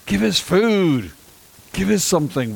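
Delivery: high, loud mock whine; whiny demand. The hungry Israelites pestering God, hammed-up whining. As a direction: nag for food like spoiled children.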